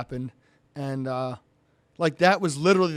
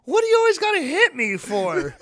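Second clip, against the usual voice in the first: high, loud hammed-up whine with laughter; mock complaint; 5 dB louder than usual. Rival driver complains during the ice-cream peace offering, mock-aggrieved. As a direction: imitate an aggrieved rival, pitched way up.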